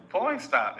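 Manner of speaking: mock girl voice. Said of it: "she was like".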